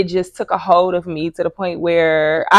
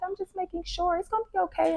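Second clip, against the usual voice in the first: put-on high, softer little girl voice; caring child voice; 9 semitones higher, 11 dB quieter than usual. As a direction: reassure mommy like a small child. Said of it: "she just be like".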